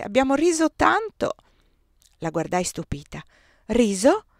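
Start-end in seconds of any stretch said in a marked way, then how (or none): 0.0-1.3 much higher and louder, tossing off the word "riso" lightly; amused chatter. A narrator's reading. Giulia tells her friend of lunch, amused and high. 3.7-4.3 "Riso?" flung back loud and rising in disbelief; shocked echo. A narrator's reading. Désirée echoes her friend's word, stunned and louder.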